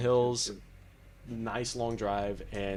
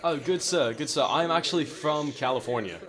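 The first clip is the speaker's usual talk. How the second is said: hammed-up polite gentleman, louder; mock gentleman; 3 semitones higher, 3 dB louder than usual. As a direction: greet them like a courtly English gent. Is the accent nice for you?.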